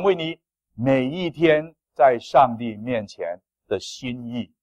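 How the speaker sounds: loud, slow, weighty closing appeal; solemn exhortation. Acted. Pastor sends the church into the new year, slow and weighty. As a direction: press each phrase home slowly.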